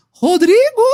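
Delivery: thin, piercing high-pitched call of the name. Retold or imitated. Retelling the spirit that called his name at night, thin high call. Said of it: "uma voz fininha".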